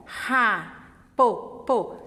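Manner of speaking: teacher intervening on a syllable; sounding out syllables. Shown as a demonstration. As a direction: repeat po to make the child hear it. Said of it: "ó".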